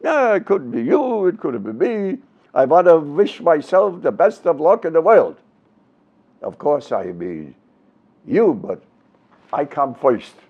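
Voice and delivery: put-on swooping Yiddish-accented comic patter; Jackie Mason. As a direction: do a stand-up routine with singsong Yiddish inflection, pitch leaping about. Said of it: "do Jackie Mason's accent".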